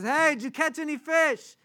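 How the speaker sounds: high, loud call across the water; yelling from shore. Acted. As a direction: holler to fishermen far off. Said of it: "he starts yelling to them".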